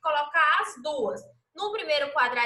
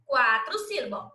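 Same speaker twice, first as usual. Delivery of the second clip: ordinary talk, nothing marked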